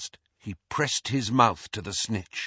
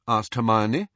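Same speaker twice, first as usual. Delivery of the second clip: ordinary delivery, nothing marked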